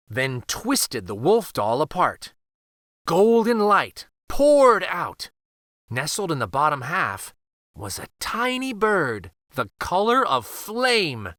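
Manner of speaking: ordinary delivery, nothing marked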